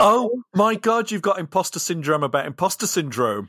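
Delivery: loud, high exclamation, easing off; amused astonishment. Real, not acted. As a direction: burst out in delighted disbelief. Teasing co-host's astonished reaction.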